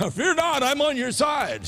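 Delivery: lifted, high-pitched mock comfort; light mock reassurance. Tryout mission message to the guy, in light mock reassurance. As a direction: play an easy, encouraging messenger.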